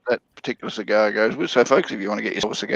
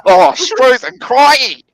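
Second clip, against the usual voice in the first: loud, high, hammed-up Australian; over-the-top Aussie accent, shouted out; strong Australian accent; 11 semitones higher, 7 dB louder, much slower than usual. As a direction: ham up a broad Australian bloke, slow on the last words. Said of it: "the real strong Australian thing".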